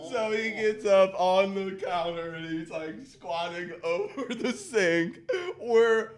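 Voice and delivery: high voice squeezed by laughter; cracking up. Real, not acted. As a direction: struggle to tell it through the giggles. Cracking up telling the sink story.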